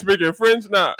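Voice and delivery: ordinary talk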